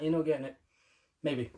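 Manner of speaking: ordinary delivery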